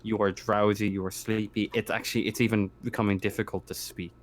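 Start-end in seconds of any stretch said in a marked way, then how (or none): none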